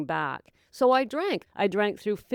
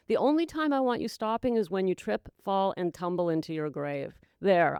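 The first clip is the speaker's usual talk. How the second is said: normal talking voice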